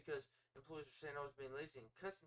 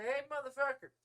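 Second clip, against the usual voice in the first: high, loud attention-grabber; acted shout to make someone listen; loud call-out; 8 semitones higher, 10 dB louder than usual. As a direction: cut across a ranting caller.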